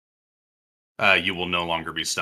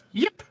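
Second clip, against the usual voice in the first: high little dog yip; imitated yip. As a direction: squeak a tiny puppy yip. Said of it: "I for sure hear a".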